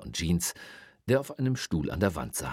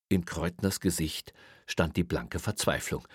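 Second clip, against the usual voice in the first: plain reading voice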